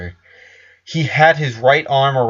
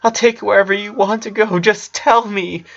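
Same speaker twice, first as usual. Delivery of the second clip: high, fast tearful plea; pleading sister; 10 semitones higher than usual. A narrator's reading. Sister pleading with her sick brother, high and rushed. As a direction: rush the offer up high.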